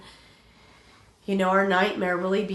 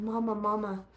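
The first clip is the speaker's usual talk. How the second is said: imitating the daughter's faint, scared call; a lightly whimpered plea for mama; scared daughter whimpering to her mother down the phone; 2 semitones higher, 5 dB quieter than usual. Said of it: "barely whimpering".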